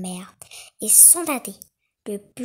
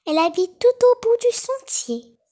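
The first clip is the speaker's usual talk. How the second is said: put-on little girl voice; 12 semitones higher, 8 dB louder than usual. A narrator's reading. Girl answers the wolf.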